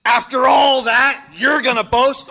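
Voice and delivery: loud, slowed preacher's shout, pitch up; shouted challenge. Shown as a demonstration. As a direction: shout it out to wake the room. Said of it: "Should I shout it?".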